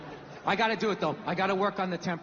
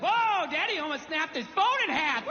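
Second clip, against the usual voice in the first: high sing-song daddy voice; forced playful brightness; fake cheery cover-up; 9 semitones higher than usual. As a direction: pass off rage as fun for a toddler. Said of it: "smooth it over really quickly".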